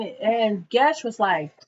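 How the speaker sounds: ordinary delivery, nothing marked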